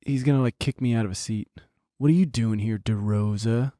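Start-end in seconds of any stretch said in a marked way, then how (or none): 2.0-3.7 lightly voiced low villain; bad guy. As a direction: confront him like a movie bully, pitched down. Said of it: "it's just a bad guy name".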